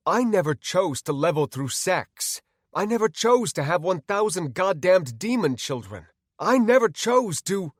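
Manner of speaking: loud, high, mounting outrage; heated, rising denial; indignant protest. Acted. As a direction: protest angrily until cut off. Man argues back, cut off.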